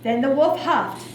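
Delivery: plain reading voice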